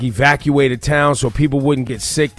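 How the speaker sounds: ordinary talk, nothing marked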